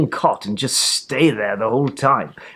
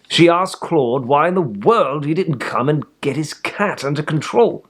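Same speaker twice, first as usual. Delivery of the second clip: ordinary delivery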